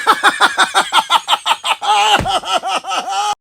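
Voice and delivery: high cackling laugh; high villain laugh. Acted. Guest laughs at his own baby-kicking joke, high cackle. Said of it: "you're laughing about kicking a baby".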